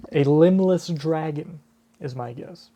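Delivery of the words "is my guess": ordinary delivery, nothing marked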